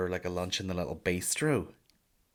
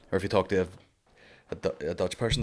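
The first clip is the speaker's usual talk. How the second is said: ordinary talk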